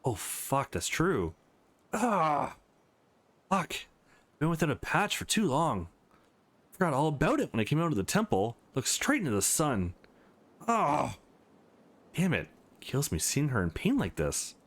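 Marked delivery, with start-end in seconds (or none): 1.9-2.5 sharp high cry of pain. Acted. Nova, eye burnt by the sun, crying out in pain. 10.7-11.2 short cry of pain. Acted. Nova, still hurting from the sun, crying out.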